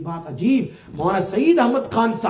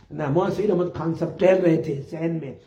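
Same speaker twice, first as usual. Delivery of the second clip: ordinary delivery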